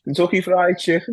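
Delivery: high-pitched mock Scouse; throaty-consonant Scouse impression. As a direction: exaggerate the Liverpool accent up high. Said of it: "how would you say that in Scouse".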